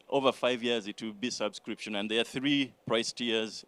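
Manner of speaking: ordinary talk, nothing marked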